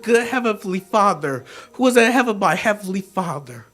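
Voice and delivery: hushed, reverent poem delivery; tender sincerity. Acted. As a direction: let it settle into quiet, heartfelt forgiveness. Poem reaches tender forgiveness.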